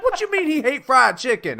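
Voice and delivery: high, loud incredulous question; outraged TSA agent. Acted. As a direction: act out a hammed-up indignant guard. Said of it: "the T S A guys are like".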